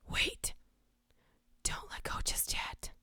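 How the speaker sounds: hushed creepy whisper into the mic. Pretend. Streamer reading a creepy chat line, whispered. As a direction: breathe it like a stalker in your ear. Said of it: "whispering something creepy".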